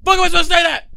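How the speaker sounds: frustrated, high and loud; exasperated. Real, not acted. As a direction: vent it loudly, exasperated. Frustrated rant at matches.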